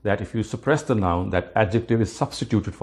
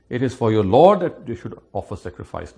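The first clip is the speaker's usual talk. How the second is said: ordinary talk, nothing marked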